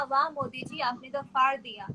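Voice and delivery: ordinary talk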